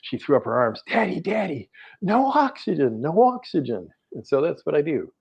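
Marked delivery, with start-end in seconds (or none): 0.9-3.9 put-on high, gleeful child's cry; excited little girl. Young daughter cheering her father without oxygen. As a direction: cry it out like a thrilled five-year-old.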